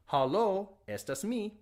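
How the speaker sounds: lively "Hello" with stress on the last syllable, ha-LO, then "estas mi"; phone greeting demo. Shown as a demonstration. Teacher acts out answering a phone, stressing LO. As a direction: answer the phone brightly, hitting the LO. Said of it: "hello should sound like hello".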